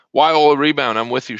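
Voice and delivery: usual speaking voice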